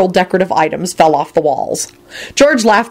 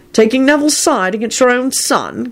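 perplexed; 6 semitones higher than usual. Acted. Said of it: "in a perplexed voice".

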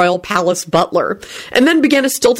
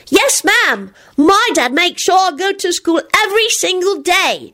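put-on high, stern little-boy voice; stern child; 12 semitones higher than usual. A narrator's reading. Teddy informs the guest about school, sternly. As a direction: inform her sternly, pitched way up. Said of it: "Teddy informed her sternly".

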